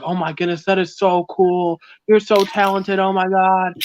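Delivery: a touch of mock fan gushing; lightly imitated gushing fans. Commenters praising the artwork on TikTok, lightly mimicked gushing.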